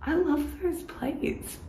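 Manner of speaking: dreamy high gush. Retold or imitated. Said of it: "I'm like".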